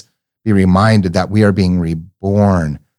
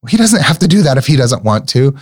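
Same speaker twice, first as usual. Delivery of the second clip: put-on a touch of a bossy little girl settling it; little niece, matter-of-fact; 6 semitones higher than usual. Niece dismissing the Buddha's crying, mildly childlike and blunt. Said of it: "She said".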